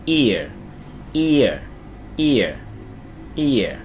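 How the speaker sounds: "ear" as one glided syllable, i sliding into ơ; đọc dính lại. Shown as a demonstration. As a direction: run i and ơ together in each "ear". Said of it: "Đọc dính lại". (đọc "ear" dính liền i với ơ)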